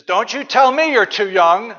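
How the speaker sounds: a playful rebuke with a laugh; scolding God. Pretend. God rebuking young Jeremiah, loud playful scolding. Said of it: "He says".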